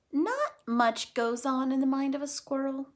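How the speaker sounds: usual speaking voice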